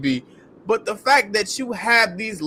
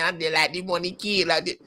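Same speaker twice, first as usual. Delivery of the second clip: mumbling imitation; 3 semitones lower, 5 dB quieter, faster than usual. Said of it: "You sound like you got a mouthful of hot grits".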